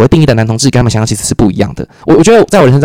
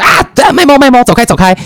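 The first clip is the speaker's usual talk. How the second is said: higher, flustered shooing; mock shooing; 10 semitones higher than usual. Coworker brushing off speaker's touch, mock flustered. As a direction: playfully act the coworker pushing him away.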